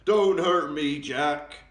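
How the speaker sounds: loud, high, wailing plea; a frightened giant begging not to be hurt; pleading yell. A narrator's reading. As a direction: cry out for mercy in terror. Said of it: "he yelled".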